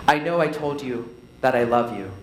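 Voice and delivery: low "I know I told you", a pause, then "that I love you"; dramatic pause. Shown as a demonstration. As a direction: let "that I love you" hang, low and grave. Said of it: "It's great for drama".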